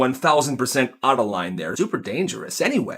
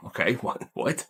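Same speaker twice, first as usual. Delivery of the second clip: much softer, baffled little okay what; stunned Owen; 3 semitones lower, 9 dB quieter than usual. Acted. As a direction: caught off guard and dazed.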